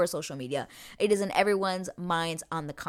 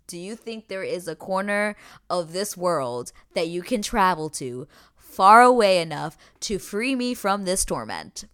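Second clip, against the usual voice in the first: dramatic declaration; 2 semitones higher, 8 dB louder than usual. Retold or imitated. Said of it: "this is when he says".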